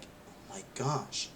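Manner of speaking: slow, breathless whispered gasp; awed whisper. Retold or imitated. Kid recalling the Green Goblin reveal, whispering in awe. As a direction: whisper it like you can't breathe. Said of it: "I was like".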